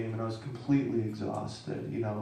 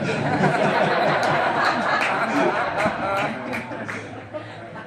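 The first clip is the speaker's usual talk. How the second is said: loud laughter; 11 semitones higher, 9 dB louder than usual. Real, not acted. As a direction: burst of high, loud laughter. Laughing at Trevor hole joke.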